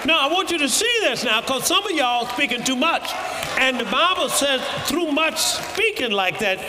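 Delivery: shouting preacher building to a climax; fiery preaching. Acted. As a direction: preach it at full force to the congregation. Preaching builds to full force.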